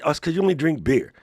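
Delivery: ordinary talk, nothing marked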